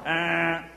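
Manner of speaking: short honk sound; imitated car horn. Honking at the stalled driver, horn imitation. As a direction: mimic a horn blast.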